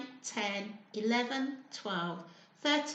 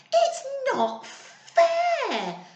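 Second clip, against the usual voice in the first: very slow, much higher, louder protest with a laugh; mock shout; 13 semitones higher, 6 dB louder, much slower than usual. A narrator's reading. Losing spiders to the other team, hammed-up slow shout. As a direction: drag out the protest, half laughing. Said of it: "the spiders on Scrabble's team shouted".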